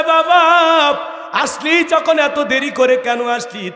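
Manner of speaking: high, tearful reproach; a touch of sobbing as she scolds him for coming late; crying neighbour. Acted. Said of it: "চাষি কাঁদে আর বলে". (কান্নাজড়িত উঁচু গলায় অভিমান)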